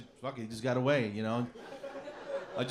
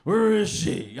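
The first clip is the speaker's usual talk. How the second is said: slow, drawn-out superhero growl; heavy, stretched-out demand; Batman; 3 semitones lower, 5 dB louder, much slower than usual. Retold or imitated. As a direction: imitate a movie vigilante's gruff interrogation. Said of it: "remember the second Batman movie".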